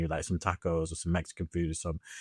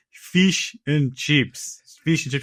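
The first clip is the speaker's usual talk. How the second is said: put-on slow, drawn-out Indian accent, louder; imitating an Indian waitress saying it carefully; 6 semitones higher, 6 dB louder, much slower than usual. Retold Indian waitress repeating the dish, mock Indian accent. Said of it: "the Indian woman says".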